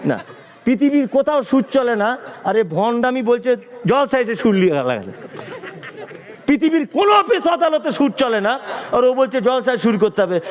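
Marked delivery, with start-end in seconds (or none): none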